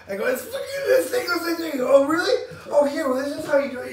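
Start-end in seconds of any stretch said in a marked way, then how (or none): 0.3-1.7 much higher, louder frustrated whine; frustrated kid. Retold or imitated. Kid fighting his bike chain, frustrated whine. 1.9-3.9 much higher, louder teasing dad voice; a hint of a smug father showing off; mock dad. Dad teasing his struggling son, light mock tone.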